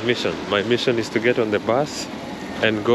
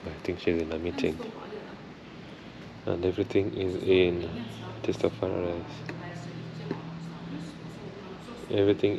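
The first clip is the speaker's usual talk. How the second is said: softer, hushed voice indoors; 3 semitones lower, 10 dB quieter than usual. Real, not acted. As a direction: keep it down so no one notices you filming. Filming quietly inside concert house.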